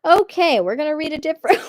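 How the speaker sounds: loud, high giving-up announcement; high-pitched bail-out. Real, not acted. Streamer abandoning a detailed Reddit post, high and loud.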